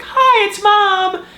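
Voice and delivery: mocking mom. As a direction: squeak out the mom greeting. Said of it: "sometimes it's like".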